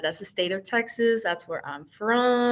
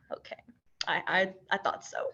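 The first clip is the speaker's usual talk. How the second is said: ordinary talk, nothing marked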